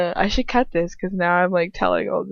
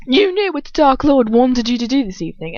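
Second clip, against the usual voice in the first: furious English villainess, loud and high; Bellatrix; 5 semitones higher, 5 dB louder than usual. A narrator's reading. Accusing Lucius over this evening, furious. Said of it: "Bellatrix asks furiously".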